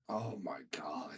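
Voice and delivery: breathy, slow whispered groan of disbelief; exasperated whisper. Retold or imitated. Gamer hearing her noisy teammates, whispering. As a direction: mutter it under your breath, fed up. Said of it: "I'm like".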